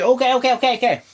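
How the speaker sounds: high, loud rapid okays; mock frantic agreement. Narrator mocking a hurried giving in, loud and high. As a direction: give in fast and flustered. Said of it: "I was like".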